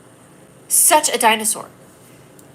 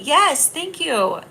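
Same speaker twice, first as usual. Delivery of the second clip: gushing thanks; 4 semitones higher, slower than usual. Real, not acted. Thanking complimenting commenter.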